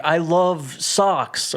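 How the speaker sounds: slow, drawn-out fake ad read; badly faked love for the product; pretending to like it. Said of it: "I can't pretend to like something".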